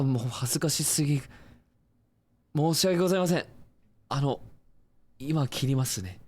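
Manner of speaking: high, loud, rushed embarrassed apology; flustered apology. Acted. Caller apologising for a wrong number, flustered and high. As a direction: panic and apologise, mortified.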